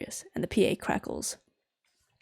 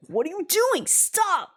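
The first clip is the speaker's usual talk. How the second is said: high, alarmed shouts; alarmed yelling; 11 semitones higher, 3 dB louder, slower than usual. Acted. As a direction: yell in alarm as it happens. Co-pilot attacked by vacuum.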